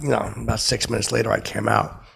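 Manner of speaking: ordinary delivery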